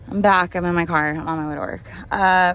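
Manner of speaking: ordinary talk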